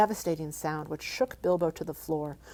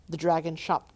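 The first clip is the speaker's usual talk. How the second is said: usual speaking voice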